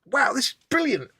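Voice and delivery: high-pitched retold wow of delight; amazed. Recalling first sight of the blacksmith's forge, amazed. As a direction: act out a thrilled discovery.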